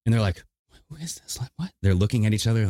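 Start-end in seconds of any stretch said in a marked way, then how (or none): 0.9-1.7 hushed, puzzled neighbor; softer, higher baffled whisper; confused hush. Retold or imitated. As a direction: whisper to each other, baffled.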